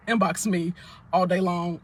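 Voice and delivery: usual speaking voice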